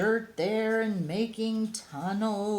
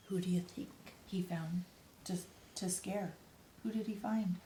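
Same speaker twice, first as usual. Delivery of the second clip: hushed whisper to the listeners; suspense whisper; 9 dB quieter than usual. Acted. Storyteller asking the children who he found, hushed whisper. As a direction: lean in and whisper the question. Narrator's suspense aside to kids.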